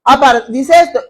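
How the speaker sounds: ordinary talk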